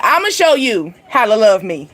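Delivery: ordinary delivery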